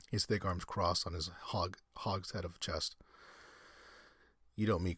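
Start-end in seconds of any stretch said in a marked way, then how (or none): none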